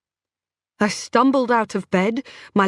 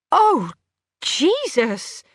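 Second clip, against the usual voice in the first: horrified gasp; 7 semitones higher, much slower than usual. A narrator's reading. Sees missing tooth.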